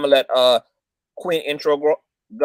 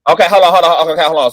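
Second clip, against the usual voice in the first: loud; 3 semitones higher, 9 dB louder, faster than usual. Real, not acted. Interrupting the heated debate.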